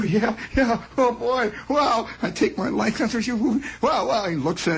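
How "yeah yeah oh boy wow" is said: high, thrilled yeahs; excited kid. Retold or imitated. Clerk thrilled to drive the truck, high eager yeahs. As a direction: gush with excitement.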